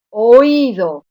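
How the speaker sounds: oído with a loud, forceful Í; stress on the I. Shown as a demonstration. Teacher giving students the solution, loud stressed Í in oído. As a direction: punch the middle Í in oído. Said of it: "la I en todos estos casos se oye con mayor intensidad".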